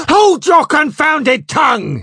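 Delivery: slow, high, full-force shout; furious bawl. A narrator's reading. Captain cutting off Flashman's excuses, bawling. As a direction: bellow to silence him. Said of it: "he bawled".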